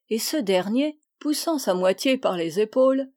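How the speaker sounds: ordinary delivery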